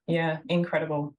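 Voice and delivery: usual speaking voice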